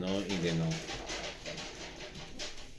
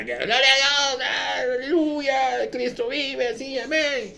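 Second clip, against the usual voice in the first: much higher, slow, hammed-up hallelujah cries; an overdone ecstatic worship leader; mock worship shout; 5 dB louder, slower than usual. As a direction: parody the over-the-top praise singer. Mocking emotional worship shouting.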